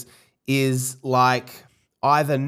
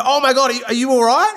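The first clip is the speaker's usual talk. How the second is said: alarmed concern; 9 semitones higher, 3 dB louder, faster than usual. Retold or imitated. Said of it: "and I go".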